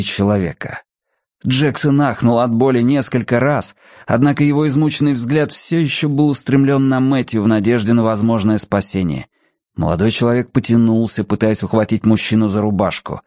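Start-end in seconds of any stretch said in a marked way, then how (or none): none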